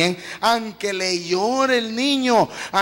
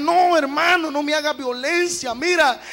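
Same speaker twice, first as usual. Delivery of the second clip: higher, louder frantic begging; pleading cry; 5 semitones higher, 3 dB louder than usual. Retold or imitated. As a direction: cry out to be let go. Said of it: "Y le gritaba". (súplica gritada, aguda y fuerte)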